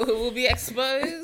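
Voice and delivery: laughing mock threat. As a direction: play it as a giggling dramatic warning.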